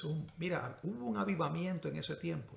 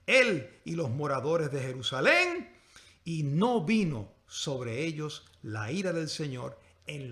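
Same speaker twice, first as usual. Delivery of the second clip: dramatic preached verse; 2 semitones lower, 10 dB louder, slower than usual. Real, not acted. Preacher reading how Ezequías humbled himself, dramatic preached verse. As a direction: proclaim the verse, then let it settle slowly.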